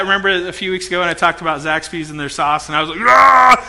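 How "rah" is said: loud mock-furious roar; short, hammed-up angry yell. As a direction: burst out in pretend rage. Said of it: "I'm not really like that".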